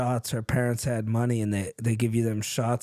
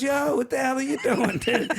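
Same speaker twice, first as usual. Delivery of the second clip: high, mock-angry yard owner through laughter; a hammed-up furious neighbour, laughing; angry homeowner; 11 semitones higher than usual. Homeowner confronts the driver tearing up his lawn, mock yell.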